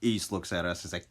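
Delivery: plain reading voice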